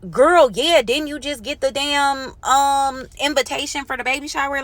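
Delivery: a high, exasperated what-do-you-mean; incredulous. Retold or imitated. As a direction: act like she should already know this. Exasperated retort to the mom.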